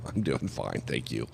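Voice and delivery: retold polite reply, kept low and quiet; low-key reply. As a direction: drop it right down, understated. Retelling his cool reply to her.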